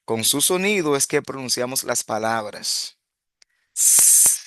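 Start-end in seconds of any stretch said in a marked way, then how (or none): 3.7-4.4 a long unvoiced hissing s; hissed s sound. Shown as a demonstration. Teacher showing the class the s sound on its own. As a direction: push air through for the s. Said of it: "con ese mismo sonido".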